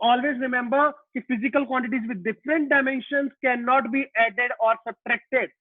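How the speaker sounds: every word stretched out for note-taking; slow dictation. Shown as a demonstration. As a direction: dictate the rule very slowly. Teacher dictating the rule again.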